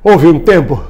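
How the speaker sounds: ordinary talk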